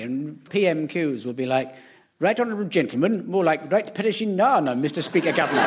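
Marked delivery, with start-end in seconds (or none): 2.2-5.6 stagey 1930s actor's learnt Cockney; posh actor straining at Cockney, every consonant in place; RADA-actor Cockney. Acted. Panellist mimics Osborne at PMQs, stagey 1930s RADA Cockney. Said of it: "nineteen thirties rada trained actor who's learnt Cockney".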